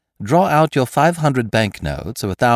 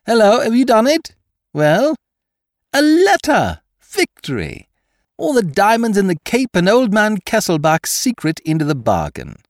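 high, loud jubilation; triumphant thief, voice leaping up; shouting in triumph; 11 semitones higher, 5 dB louder than usual. A narrator's reading. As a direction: crow your victory to the captive and accomplice. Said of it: "he shouted in triumph".

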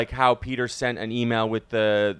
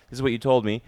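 ordinary talk, nothing marked

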